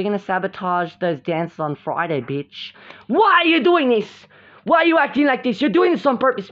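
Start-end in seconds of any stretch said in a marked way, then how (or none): 3.1-6.5 high, heated play-quarrel voice; acted accusation. Accusing rivals of sabotage on purpose, heated high voice. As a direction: accuse them, pitched up and louder.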